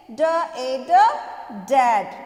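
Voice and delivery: ड ए ड loud and separate, then blended into डैड; sounding out. Shown as a demonstration. Teacher answering her own drill, loud ड ए ड blended to डैड. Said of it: "क्या बोलेंगे".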